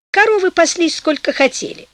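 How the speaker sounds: usual speaking voice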